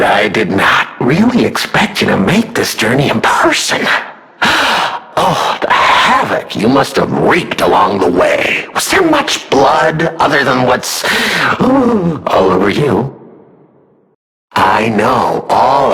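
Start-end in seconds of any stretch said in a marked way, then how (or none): 4.4-5.1 sharp mock-delighted gasp; hammed-up gasp of glee; theatrical gasp. 11.1-11.5 sharp mock-delighted gasp; hammed-up gasp of glee; theatrical gasp.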